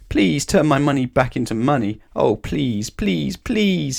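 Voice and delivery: mock desperate begging. Miser begging the wizard to restore his money, pleading. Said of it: "he begged".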